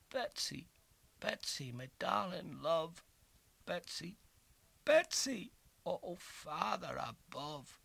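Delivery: high broken calling, fading; despairing call. A narrator's reading. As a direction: call her name, breaking down. Calling lost love in despair.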